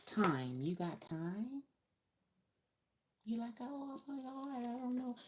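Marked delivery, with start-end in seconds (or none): 3.6-5.2 high, soft dithering voice; high-pitched little mock fretting. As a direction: imitate someone at a loss, high and quiet. Acting out a hesitant person.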